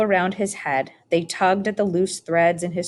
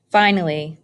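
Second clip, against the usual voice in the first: plain reading voice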